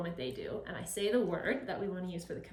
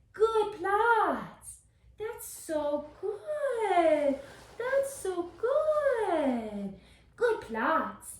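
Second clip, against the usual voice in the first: higher, louder, very slow stretched praise; drawn-out praise; 10 semitones higher, 6 dB louder, much slower than usual. Real, not acted. Trainer rewarding puppy holding a down, slow high praise. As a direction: sing out the reward slowly.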